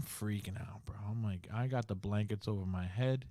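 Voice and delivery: hushed, scared whisper. Real, not acted. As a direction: whisper as if hiding under the covers. Scared under the blankets.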